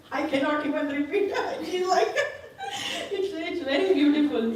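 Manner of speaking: real giggling, pitch shooting up; high, laughing delivery. Real, not acted. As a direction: laugh helplessly between the words. Laughs while declining to repeat.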